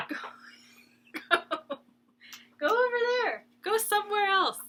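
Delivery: high, loud shooing call; shooing the dog. Real, not acted. Telling her needy dog to go away, high and loud.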